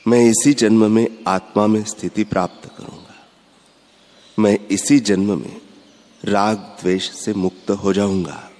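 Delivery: ordinary talk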